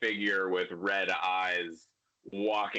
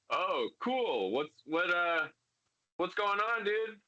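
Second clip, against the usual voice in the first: high, startled and casual, surfer-ish dude; a master caught off guard, playing it cool; jittery high voice of a startled mentor; startled Jedi master; 9 semitones higher than usual. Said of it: "and he's like".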